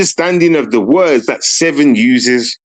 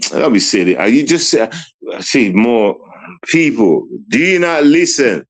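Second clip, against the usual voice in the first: normal talking voice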